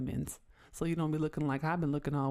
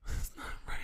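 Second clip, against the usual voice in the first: whispered aside; whispering; 4 dB quieter, faster than usual. Real, not acted. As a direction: drop to a whisper.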